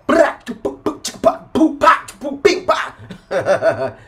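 high wild cackling laugh burst; signature high cackle. Real, not acted. Telling a story to viewers, breaks into high cackle. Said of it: "You guys like it when I do that".

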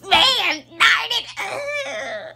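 high, loud, half laughing whine; hammed-up frustrated yell; mock crowd groan. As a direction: shout it out like a sore loser. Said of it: "they're like".

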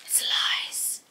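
whispered under her breath; whispering. Real, not acted. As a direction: hush it to a whisper. Frustrated at packaging that won't tear.

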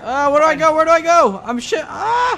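high, loud, frantic gamer panic; panicked yelling. Real, not acted. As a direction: cry out while cornered, rising to a yelp. Fleeing zombie with no bullets.

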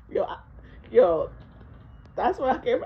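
normal talking voice

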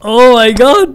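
very high, loud shocked yell. Real, not acted. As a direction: scream it in disbelief. Shocked at a fall. (صرخة صدمة عالية جدا)

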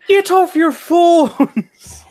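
very high, loud, long-drawn screech; mock an outraged stranger, stretching every word; mimicked yell. Imitating the woman scolding them over phones, mimicked yell.

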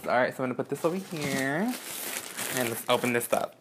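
usual speaking voice

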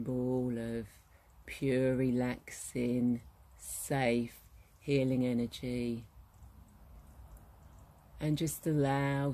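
guided meditation. Acted. Guide leading listeners into relaxation at the well, guided meditation voice. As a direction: ease the listener gently into calm, unhurried.

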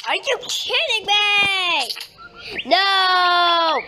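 a loud outraged yelling protest; outraged shout. Real, not acted. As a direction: yell it out, fed up. Players wrecking his base.

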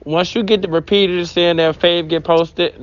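ordinary delivery

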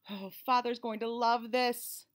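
usual speaking voice

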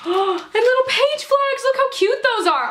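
high, drawn-out cooing excitement; gushing delight. Real, not acted. Vlogger finding cute page flags, high-pitched delight. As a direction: gush over it in a high voice.

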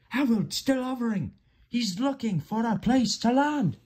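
very high triumphant cry; excited shout. A narrator's reading. Fireman from the cab roof, shouting in triumph. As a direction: cheer in a high character voice. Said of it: "he shouted".

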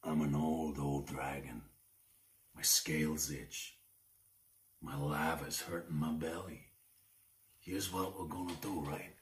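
low, soft menacing dragon voice; old dragon. Acted. Dragon threatens mother.

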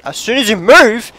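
building to a loud shout on DIE; frustrated gamer rant; exasperated outburst. Real, not acted. Rages at dying in game.

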